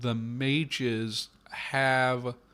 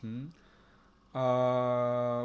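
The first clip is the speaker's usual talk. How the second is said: usual speaking voice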